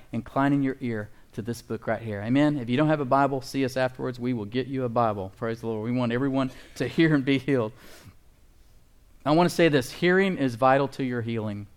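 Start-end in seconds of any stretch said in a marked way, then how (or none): none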